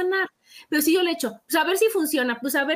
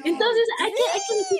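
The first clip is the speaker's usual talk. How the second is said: laughing; 7 semitones higher than usual. Real, not acted. Laughing lead into affirmation.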